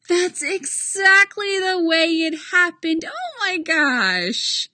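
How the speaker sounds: high, gloating, drawn-out triumph; smug and pleased. Acted. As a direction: savour being proven right. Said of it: "looking entirely too pleased with herself".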